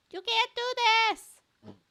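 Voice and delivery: put-on high loud then breaking young hero; protesting boy hero. Young hero protesting to the villain, high loud outcry. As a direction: cry out in disbelief.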